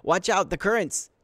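high, loud cry of alarm; warning shout. Acted. Swimmer warns of the river's currents, shouted warning. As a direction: yell a warning over the water.

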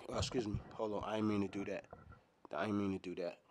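hushed apology, half off mic; soft embarrassed aside; quiet aside. Real, not acted. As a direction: murmur a sorry under your breath. Apology aside after a slip.

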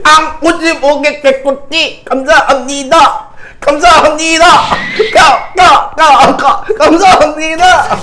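high, loud, stretched-out thank-you; excited shouting thanks. Real, not acted. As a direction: yell your thanks over the top. Thanking for a big donation. (흥분해서 외치는 감사 인사)

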